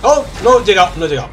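loud frustrated cry; frustrated shout. Real, not acted. Missed a jump in game.

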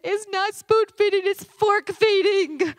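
put-on high, loud, slow little girl retort; sassy four-year-old. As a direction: deliver the fork line with kid logic. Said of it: "She said".

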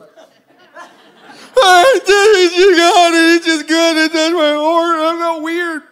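loud, high, drawn-out comic wail; mock bawling. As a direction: wail it like a crying baby, hammed up. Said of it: "I'm just crying like just a baby".

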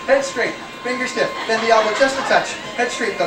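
normal talking voice

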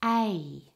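"A" as a full gliding double vowel. Shown as a demonstration. Teacher corrects a mispronounced vowel, "A" as a double vowel. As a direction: draw out both sounds of the vowel "A". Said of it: "That is a double vowel".

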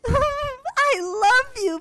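high squealing laugh into i love you; swooning giggle; giggly love gush. Acted. Swooning over rich boyfriend.

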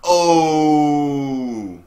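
loud drawn-out falling "ooh"; long groan of reaction, trailing down. Real, not acted. Reacting to the screen.

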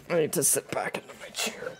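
whispered aside; hushed while hunting. Real, not acted. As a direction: whisper so as not to spook.

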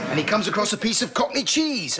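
usual speaking voice

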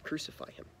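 a whispered, breathy crowd demand; hushed crowd cry. A narrator's reading. Teacher reading the crowd's demand to kids, whispered. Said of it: "the crowd kept shouting".

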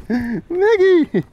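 high, loud happy call of her name; joyful high call to a beloved dog; excited greeting. Real, not acted.

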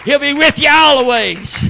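fervent preaching, rising into shouted peaks; full-force preaching. Real, not acted. Preacher testifying to the congregation about holy joy, full-force preaching. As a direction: preach it hard, punctuated with hallelujahs.

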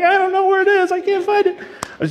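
very high, frantic squeal, hammed up; mock panic. Pastor retelling a dream search for video footage, mock panic. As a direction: squeal it like someone flustered and searching.

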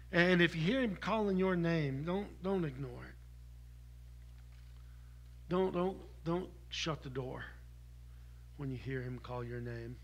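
quiet earnest plea; soft pleading. Acted. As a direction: draw them in close.

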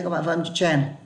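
ordinary talk

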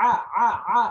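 "আ" pushed out loud from the throat. Shown as a demonstration. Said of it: "গলা থেকে উচ্চারণ আন". ("আ" গলা থেকে জোরে উচ্চারণ)

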